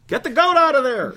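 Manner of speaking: a hammed-up cry to get the goat out; mock outburst. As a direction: bark it out as a joke. Joking outburst about the goat law.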